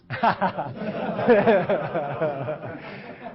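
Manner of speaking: real, long burst of laughter; hearty laugh. Real, not acted. Coach after his herpes joke, hearty laugh. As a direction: crack up at your own joke.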